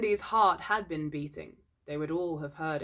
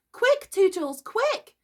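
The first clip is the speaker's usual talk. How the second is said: very high, piercing fairy cry; screamed urging; 6 dB louder, slower than usual. A narrator's reading. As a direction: urge him on in a loud squeal. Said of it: "she screamed".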